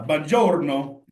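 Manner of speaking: a playful fake-Italian buongiorno; mock Italian greeting. Teacher tosses out a mock Italian greeting, hammed up. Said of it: "then it may be Italian".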